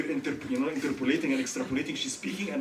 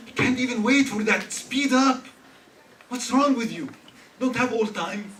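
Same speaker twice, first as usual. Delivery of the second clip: impatient scolding; 6 semitones higher, 8 dB louder than usual. Retold or imitated. Voicing an impatient person.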